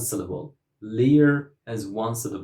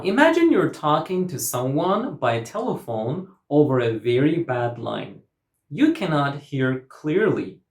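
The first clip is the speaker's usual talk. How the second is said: ordinary talk, nothing marked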